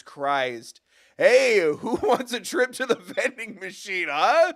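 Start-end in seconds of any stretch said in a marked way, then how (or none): 1.2-4.5 loud, high jeering guard; hammed-up guard taunt; mock guard taunt. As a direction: call out like a smug guard teasing a kid.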